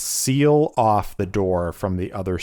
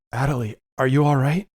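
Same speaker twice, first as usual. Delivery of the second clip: higher, quick anxious asking; worried question; 6 semitones higher, much faster than usual. Acted. Lucius reaching out to swaying Adelie, anxious higher question. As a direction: rush to check on her. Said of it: "and is like".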